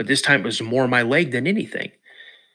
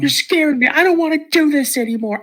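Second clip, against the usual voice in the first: put-on high, loud frightened kid voice; scared child; 14 semitones higher, 4 dB louder than usual. Boy begging dad to take him home, high and panicked. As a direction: plead like a panicked seven-year-old. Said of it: "I go".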